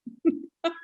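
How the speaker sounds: high, soft laugh; amused laugh. Real, not acted. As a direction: laugh at your own good luck. Laughs at her own luck.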